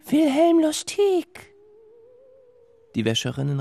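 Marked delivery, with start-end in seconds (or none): 0.1-1.5 high, slow eerie voice; sing-song like a phantom; otherworldly washerwoman voice; ghostly women. Acted. Ghosts name the doomed man.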